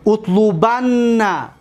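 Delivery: اطلبن drawn out slowly, utlubanna with doubled n; slow Arabic demo. Shown as a demonstration. Teacher drawing out an Arabic verb for students, slow and deliberate. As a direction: spell out اطلبن syllable by syllable, doubled نّ at the end. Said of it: "در امر حاضر معلوم گویی".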